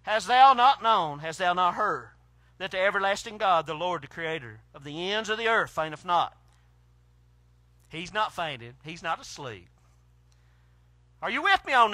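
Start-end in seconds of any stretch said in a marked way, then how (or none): 0.0-6.4 measured, solemn King James reading; scripture reading. Acted. Preacher reading Isaiah to the congregation, solemn. Reads scripture aloud slowly.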